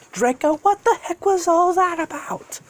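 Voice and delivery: high-pitched, indignant character voice; indignant young hero. Acted. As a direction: demand an explanation, voice way up. Deku protesting to dragon afterward.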